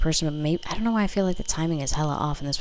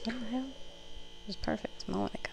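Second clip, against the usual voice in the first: much softer, whispered in annoyance; muttered under breath; 5 semitones higher, 13 dB quieter than usual. Real, not acted. Reader grumbling to herself about the messy card spread, whispered. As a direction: grumble it to yourself, barely audible.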